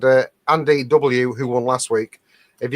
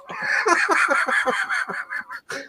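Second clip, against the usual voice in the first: big burst of laughter; 5 dB quieter than usual. Real, not acted. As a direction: break into a long helpless laugh. Laughing at own joke.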